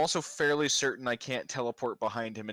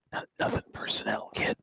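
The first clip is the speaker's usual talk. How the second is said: voiceless, theatrical tough-guy whisper; menacing rasped whisper. Acted. Player teleports behind the big bad guy, whispered menace. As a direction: whisper it like a cool assassin.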